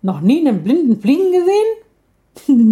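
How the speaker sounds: indignant squawk at the lion cub; indignant bird. A narrator's reading. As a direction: blind bird scolding the laughing cub.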